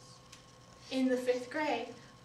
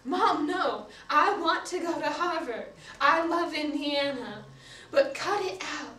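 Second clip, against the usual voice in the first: higher, louder pleading with mom; exasperated protest; 3 semitones higher, 4 dB louder than usual. Acted. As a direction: insist to mom, raised voice and fed up. Daughter protests to mother in story.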